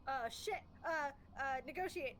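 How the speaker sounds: loud, high panicked shout; panicked yelling. Acted. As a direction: yell it in a scramble of panic. Panicked player facing masked intruder.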